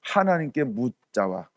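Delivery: soft, slow, humble petition; reverent hush. Retold or imitated. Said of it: "사무엘이 어떻게 했다고요?".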